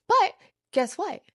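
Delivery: sing-song guess what; high teasing. Retold or imitated. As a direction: tease it up high. Lawyer's twist in story.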